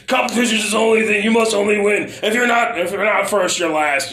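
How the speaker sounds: loud, fast, pumped-up jock; macho bravado voice. Retold or imitated. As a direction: bark it like a hype coach. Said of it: "the hoo-hoo bravado bullshit".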